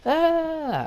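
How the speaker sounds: high wordless wail, imitating an angry chaperone; sustained high "ah" standing in for yelling; mock yell. Chaperone angry at the girls, high mock yell. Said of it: "She was yelling".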